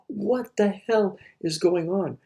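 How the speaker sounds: drawn-out mock exasperation at the world; bewildered outrage. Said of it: "and you go".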